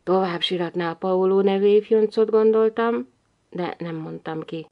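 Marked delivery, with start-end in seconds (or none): none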